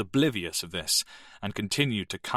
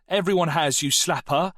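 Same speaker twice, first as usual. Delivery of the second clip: a louder, higher jeering shout; 8 semitones higher, 5 dB louder than usual. Acted. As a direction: yell the insult across the room. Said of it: "Dale shouted".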